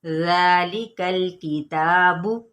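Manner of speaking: slow recitation of ज़ालिकल किताबु; slow tajweed chant; Quran recitation. Shown as a demonstration. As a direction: recite it slowly, with a short final u. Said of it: "लंबा नहीं करना".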